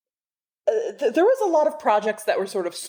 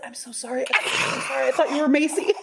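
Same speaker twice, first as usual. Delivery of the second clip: flustered apology; 7 semitones higher than usual. Retold or imitated. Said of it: "and I said".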